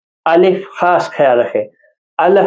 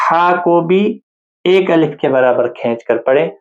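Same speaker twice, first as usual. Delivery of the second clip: normal talking voice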